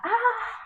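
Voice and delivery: high film-style gasp of fright; a sudden shriek like in a horror film; mock film scream. Scared film character gasping. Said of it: "in films, we're like".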